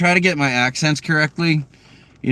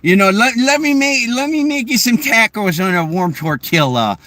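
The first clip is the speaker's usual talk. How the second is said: high-pitched, hammed-up Midwestern accent; Midwest Fargo accent; 6 semitones higher than usual. Shown as a demonstration. Offering someone food, exaggerated Midwest Fargo accent. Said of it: "Midwest Fargo".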